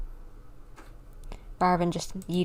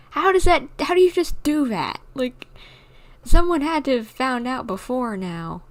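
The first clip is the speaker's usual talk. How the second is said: louder, higher, baffled questions; excited disbelief; 6 semitones higher, 6 dB louder than usual. Real, not acted. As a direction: press the character in disbelief. Streamer reacts to game twist.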